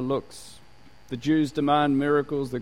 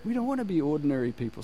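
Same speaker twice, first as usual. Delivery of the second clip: imitating indignant listeners, pitched high; high-pitched mock grumbling, put on; mock public complaint; 6 semitones higher than usual. Preacher voices listeners criticising the radio change, mock indignant. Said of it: "everybody went".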